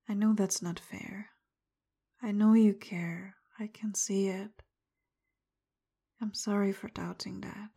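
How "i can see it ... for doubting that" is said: usual speaking voice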